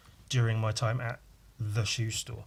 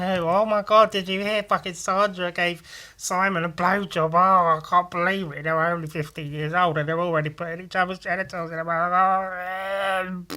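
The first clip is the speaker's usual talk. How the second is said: higher, gushing gossip voice; mock gossiping girl; 5 semitones higher than usual. Skater kids mock the girls' gossip, higher gushing voice. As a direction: ham up a scandalised schoolgirl. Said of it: "And then we'd say".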